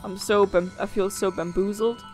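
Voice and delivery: normal talking voice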